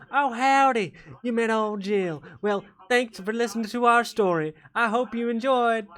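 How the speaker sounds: high-pitched deep south farm woman; Jill's deep south accent. Acted. Demon wife greets the player in a high deep south accent. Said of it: "everyone has to do that accent".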